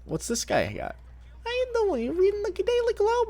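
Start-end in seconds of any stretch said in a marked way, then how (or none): 1.4-3.3 very high squeaky Leno voice; Jay Leno impression. Streamer imitating Vinny imitating Jay Leno, very high squeak. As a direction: squeak every line like a cartoon talk-show host.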